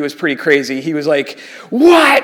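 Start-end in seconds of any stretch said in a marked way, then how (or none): 1.7-2.2 high, loud, incredulous outburst; retold yell. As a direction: imitate a crazy military buddy yelling in disbelief. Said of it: "And he's yelling".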